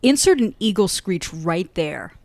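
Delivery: ordinary talk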